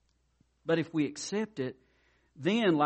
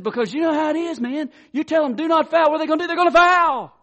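much higher and louder, building to a shout; a coach fired up, getting louder at the punchline; high, loud, worked-up coach voice; animated coach; 13 semitones higher, 11 dB louder, faster than usual. Retold or imitated. Coach explains, voice rising loud.

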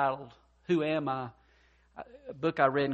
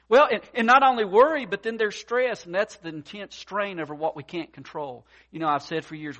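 ordinary talk

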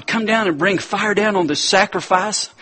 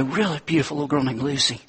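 normal talking voice

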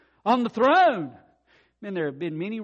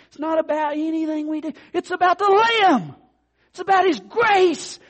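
higher, louder, building fervour; loud preaching; 9 semitones higher, 7 dB louder than usual. Real, not acted. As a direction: drive it home with fire. Preaching climax.

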